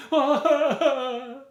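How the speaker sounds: hammed-up wailing. Ruined tailor wails over losing his customers, hammed-up. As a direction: wail like it's all over, overdone. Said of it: "He cried until he ran".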